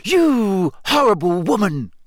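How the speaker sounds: high, slow angry outburst; seething, each word drawn out; through gritted teeth. A narrator's reading. Hermione standing up to Rita, gritted-teeth anger. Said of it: "said through gritted teeth".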